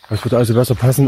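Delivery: ordinary talk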